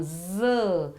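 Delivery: ज said as a buzzing z, not j; ज with the tongue hissing like English z; careful clear ज as z; z sound. Shown as a demonstration. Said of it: "ध्यान से वो सुनो".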